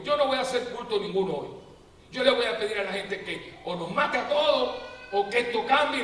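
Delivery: fed-up pastor building to a cry; exasperated pastor. Retold or imitated. Tired Lutheran pastor to his flock, building loud.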